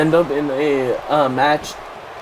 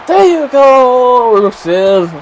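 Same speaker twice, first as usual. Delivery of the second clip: much higher, louder and slow cheering shout; excited shout; 9 semitones higher, 7 dB louder, slower than usual. Real, not acted. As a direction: cheer him on at the top of your voice. Cheering on wrestler in game.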